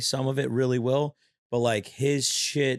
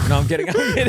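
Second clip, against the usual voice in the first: loud laughing no I'm kidding, pitched up; laughing backpedal; 8 semitones higher, 8 dB louder, much faster than usual. Real, not acted. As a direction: take it back through laughter.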